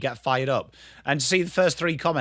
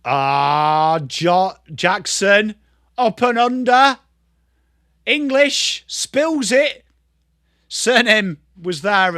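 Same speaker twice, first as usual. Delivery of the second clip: drawn-out, raised footy call; live play-by-play; 5 semitones higher, 6 dB louder, much slower than usual. Real, not acted. Commentator calling Jackson's up and under, stretched and raised. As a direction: stretch out each name as the play unfolds.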